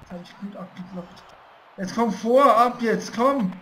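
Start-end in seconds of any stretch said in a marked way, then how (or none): 1.8-3.5 loud, pushing urging; will the game on, loudly; urgent cheering. Real, not acted. (anfeuernd laut)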